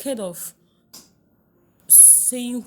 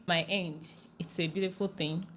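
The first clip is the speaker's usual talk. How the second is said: usual speaking voice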